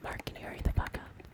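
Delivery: hushed whisper; playful whispered nagging; mock ASMR whisper. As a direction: breathe it into the mic. ASMR bit continues.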